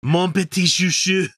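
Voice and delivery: hammed-up French lover voice, rising; lightly mocking a French Canadian accent. Cohost mocking a guest's French accent. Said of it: "That accent is bullshit".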